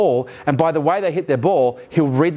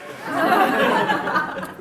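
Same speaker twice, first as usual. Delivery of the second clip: high airy burst of laughter; real laugh. Real, not acted. Laughing at his own envious outburst. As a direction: crack up at the memory.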